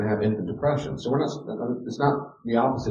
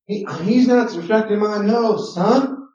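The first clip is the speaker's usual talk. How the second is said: put-on high, louder whiny kid complaint; tattling child; 11 semitones higher, 6 dB louder, slower than usual. Child complaining about sibling to parent, high mock whine.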